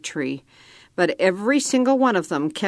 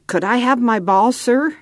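rasped, hiding fear; 6 semitones higher than usual. A narrator's reading. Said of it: "I rasped".

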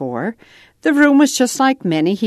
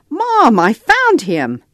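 louder, higher and slow, calling out the find; excited shout; 7 semitones higher, 5 dB louder, slower than usual. A narrator's reading. Boy calling to his mom across the center, excited shout. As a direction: shout it across the room, thrilled. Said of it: "Nathan shouted".

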